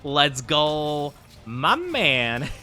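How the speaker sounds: drawn-out, swooping "my man"; playful hype greeting. Pretend. Joking with chat over a misread phrase, playful drawn-out hype. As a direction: hail a buddy with a playful swoop.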